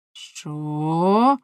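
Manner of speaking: a loud, startled What; shocked shout. Acted. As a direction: blurt it out in shock. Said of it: "закричав Ламе". (шокований вигук)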